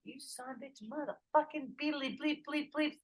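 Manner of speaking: high-pitched retold tirade; acted-out indignant rant; mock cursing. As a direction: rattle off self-censored swearing in a high voice. Retells cursing out the team.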